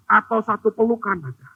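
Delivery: ordinary talk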